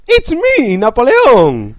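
very high, loud cartoon catchphrase voice; hammed-up, high-pitched comic announcement; Napoleón. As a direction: squeal it out, then stretch the name slowly. Comic impression of Napoleon, Mario-like.

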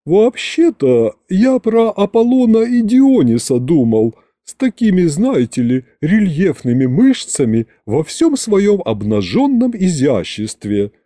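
dreamy, languid butler, high; languid sigh. Acted. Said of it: "томно вздохнул дворецкий". (томно, мечтательно)

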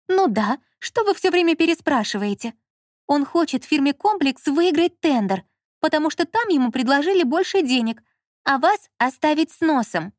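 high, heated woman's voice; Lola as wronged wife. A narrator's reading. Lola warning the director about her husband, aggrieved.

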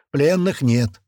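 upbeat voice over the radio; cheerful radio voice. A narrator's reading. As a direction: report it gleefully over the radio. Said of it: "весёлым голосом паштета". (весёлый голос по рации)

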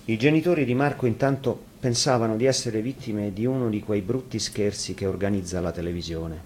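ordinary delivery, nothing marked

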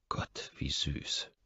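very soft, slow whisper; whispered thought. A narrator's reading. Narrator privately charmed by her offer, whispering slowly. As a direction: breathe it out slowly under the breath.

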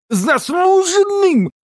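very high, slow outraged bellow; furious yell. Acted. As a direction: roar the word in rage. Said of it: "заорал дикобраз".

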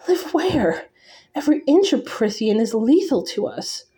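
pitched well up, disbelieving objection; incredulous protest. A narrator's reading. Girl objecting to living in a lethal land, high incredulous protest. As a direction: throw the offer back in disbelief, high-pitched.

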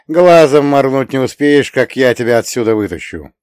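bright, confident promise; upbeat. A narrator's reading. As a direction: cheer her up boldly. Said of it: "оптимистично заявил я".

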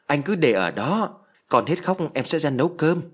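calling out from another room. A narrator's reading. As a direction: call out through the door. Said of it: "nói vọng ra".

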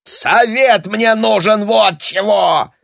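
high, slow, drawn-out angry yell; bellowing. A narrator's reading. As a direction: roar it out word by word. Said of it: "заорал Папандополо".

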